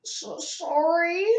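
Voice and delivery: high, slow, breathy sob; sobbed. A narrator's reading. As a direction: sob out the apology. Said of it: "sobbed Hagrid".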